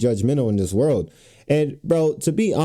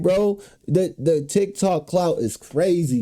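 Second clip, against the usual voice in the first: ordinary talk, nothing marked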